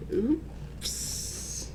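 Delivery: breathy high whisper; hushed, caught out; whispered oops. Acted. Santa realises his mistake.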